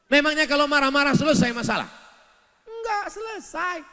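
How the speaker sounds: high, loud and fast; loud fast rebuke; fiery rhetorical question. Acted. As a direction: fire the question at them. Preacher rebuking anger.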